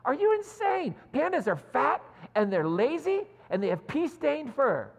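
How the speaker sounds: a hammed-up scandalized retort; indignant outburst. Rebecca scolding Ted over pandas, in mock outrage. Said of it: "this triggers Rebecca".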